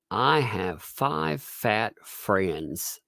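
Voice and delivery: very slow, measured diction; pronunciation exercise. Shown as a demonstration. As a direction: recite it word by drawn-out word. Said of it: "helps you afterwards to pronounce things".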